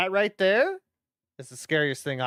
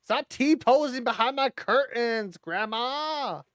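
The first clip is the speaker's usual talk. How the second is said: shouting; 8 semitones higher, slower than usual. Real, not acted. Yelling at grandma in game.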